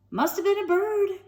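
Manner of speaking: usual speaking voice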